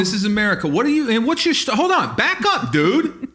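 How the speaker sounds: high, indignant challenge; hammed-up defensive outrage; mock American confrontation. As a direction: square up to a stranger, pitch climbing. Acting hostile American confronting the robot.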